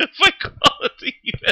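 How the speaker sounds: high, laughing speech; laughing through words. Real, not acted. Trying to explain through his laughter, laughing. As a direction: talk while cracking up.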